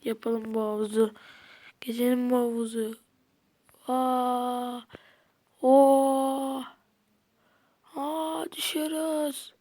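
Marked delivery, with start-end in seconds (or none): 3.9-9.5 surprised shout. Real, not acted. Surprise in game.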